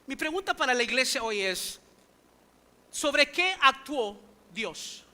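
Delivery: ordinary delivery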